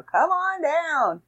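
high, slow hammed-up sales pitch; used car salesman. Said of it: "I sound like a used car salesman now".

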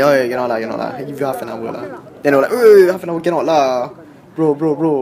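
loud, laughing haggling. Retold or imitated. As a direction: haggle loudly, half laughing. Retold haggling, louder.

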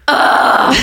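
breathy fed-up groan; exasperated ugh. Retold or imitated. Roommate home from work, groaning in exasperation. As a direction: drop the keys and let out a weary ugh. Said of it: "and then her going".

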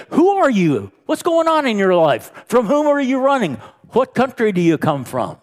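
high, loud accusing questions; angry sailors. Acted. Storm-tossed sailors confronting Jonah, loud and high. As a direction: demand it right in his face. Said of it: "these angry sailors in his face".

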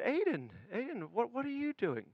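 put-on soft, hushed night voice to a child; a hushed, concerned dad in the dark; hushed father. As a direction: speak gently so as not to wake anyone. Father whispering to son at night.

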